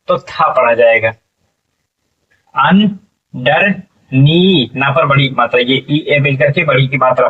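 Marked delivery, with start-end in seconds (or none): 2.5-4.7 syllables pulled apart slowly, one by one; slow sounding out. Shown as a demonstration. Teacher to pupils, slow syllable-by-syllable sounding out. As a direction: spell the word out slowly for the class.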